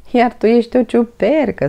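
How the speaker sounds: laughing tease. A narrator's reading. Albert teases Toni about the mushroom, laughing. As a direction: giggle through the joke. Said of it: "spuse râzând Albert".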